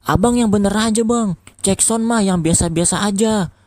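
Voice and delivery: high-pitched grumbling local; complaining neighbour. A narrator's reading. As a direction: scold him like a fed-up neighbour. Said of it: "kata warga lagi".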